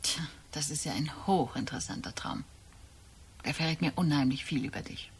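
dry whispered remark. Acted. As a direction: hush it, voiceless and knowing. Wife reacts to dream. (trocken geflüstert)

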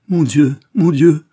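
loud, high, slow wail of grief; despairing outcry. A narrator's reading. Husband breaking down at dying wife's bedside, despairing. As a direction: cry out in drawn-out despair. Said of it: "Il éclata en sanglots".

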